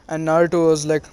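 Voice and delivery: ordinary delivery, nothing marked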